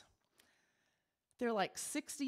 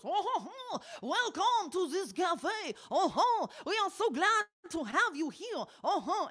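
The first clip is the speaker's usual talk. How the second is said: high, loud hammed-up jolly host with belly chuckles; jolly chef voice; 8 semitones higher, 4 dB louder than usual. The chef welcoming us into the cafe, booming jolly voice. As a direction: ham up a jovial cartoon chef greeting guests. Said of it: "he literally goes".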